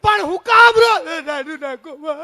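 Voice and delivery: high, loud, drawn-out yell; exasperated husband shouting, stretched high. Acted. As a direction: yell over someone's wailing. Uncle yells at wailing aunt.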